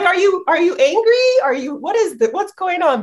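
baffled by a snarling kiss; voicing confused person. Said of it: "So she's just like".

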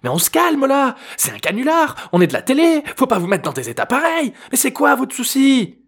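high, strained pleading voice; calm them down in a panic; flustered prankster. A narrator's reading. (voix aiguë affolée de farceur)

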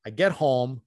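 usual speaking voice

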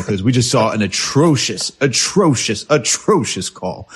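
each atrocious hit harder, the last one slow; a disgusted podcaster hammering atrocious; repeated emphasis. Real, not acted. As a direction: lean on atrocious over and over, slowing down at the end. Ranting about a bad call.

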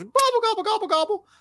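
high gobbling turkey call, repeated; turkey gobble. Retold or imitated. Imitating a turkey that gobbled all night at the campout. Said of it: "I did not expect you to do a turkey impression".

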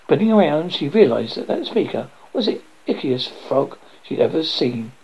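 plain reading voice

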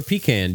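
pecan said as PEE-can; PEE-can pronunciation. Shown as a demonstration. Host offering the other way to say it, pecan as PEE-can. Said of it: "Do you say pecan or pecan".

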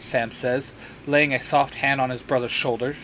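plain reading voice

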